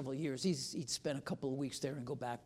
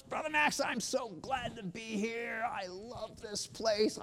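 high, gushing, starry-eyed praise; mock the fresh arrival's raptures; gushing newcomer; 8 semitones higher, 5 dB louder than usual. Preacher mimics the new missionary, gushing high. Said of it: "David starts waxing eloquent".

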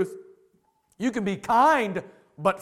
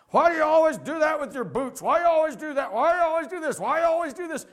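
nagging wife; 7 semitones higher, faster than usual. Retold or imitated.

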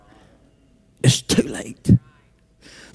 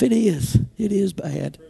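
ordinary talk, nothing marked

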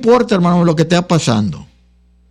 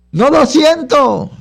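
doubting believer; 8 semitones higher, slower than usual. Acted. Voicing a doubting church member.

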